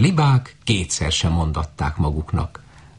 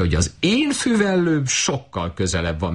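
indignant goose; 8 semitones higher than usual. A narrator's reading. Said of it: "méltatlankodva gágogott".

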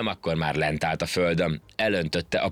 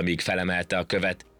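ordinary delivery

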